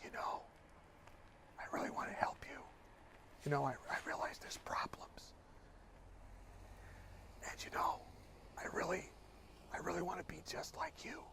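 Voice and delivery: soft, voiceless whisper with pauses; hushed whisper to calm someone down. Retold or imitated. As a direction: whisper it earnestly, haltingly. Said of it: "I just began to whisper".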